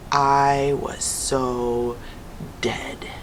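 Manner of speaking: slow, fading to a whisper; dramatic hush. A narrator's reading. Charlie realizing his fate, slowing into a whisper. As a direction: let it die away into a whisper.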